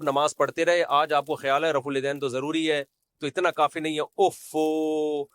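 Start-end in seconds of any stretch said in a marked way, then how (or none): 4.0-5.2 very long drawn-out put-on regret; mock dismay. Preacher mimicking regret over past prayers, mock dismay. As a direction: stretch out a mocking "oh no". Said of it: "اوفو کر کے acting".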